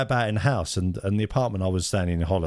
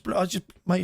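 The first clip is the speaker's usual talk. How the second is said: ordinary talk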